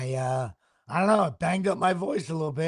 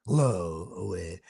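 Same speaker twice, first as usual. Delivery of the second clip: lower, dropped voice to spare the throat; speaking lower; 9 semitones lower, 5 dB quieter, slower than usual. Shown as a demonstration. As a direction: push the voice down low. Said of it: "I have to speak more lower".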